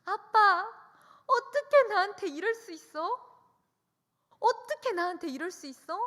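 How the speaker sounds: high, loud tearful shout at dad; a child screaming betrayal at her father; wailing shout. Retold or imitated. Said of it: "울고불고 소리를 칩니다". (아빠에게 울며 높고 크게 소리치는 딸)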